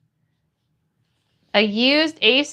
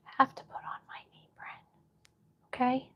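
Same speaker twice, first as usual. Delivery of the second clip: whispered aside, very soft and quick; 14 dB quieter, faster than usual. Real, not acted. Streamer repeating the task to herself, whispered. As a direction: whisper it quickly under your breath.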